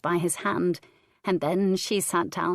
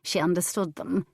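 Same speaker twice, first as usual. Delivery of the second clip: ordinary delivery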